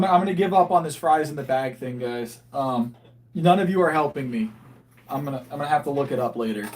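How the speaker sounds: ordinary delivery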